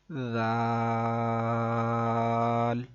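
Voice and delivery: زال with soft voiced th and long-held aa; soft reading. Shown as a demonstration. Teacher demonstrates the soft letter, drawn-out gentle زال. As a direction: start زال gently, then stretch it evenly. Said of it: "نرمی سے پڑھیں گے".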